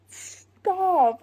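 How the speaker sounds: high, loud giddy "stop"; overwhelmed fangirl squeal. Real, not acted. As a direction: squeal it like it's too cute. Gushing over the cute scene.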